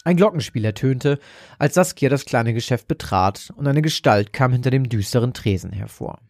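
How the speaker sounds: ordinary delivery, nothing marked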